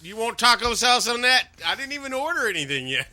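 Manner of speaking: high, loud cartoon voice; cartoon customer. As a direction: squawk it like a cartoon. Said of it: "drive-thru skit".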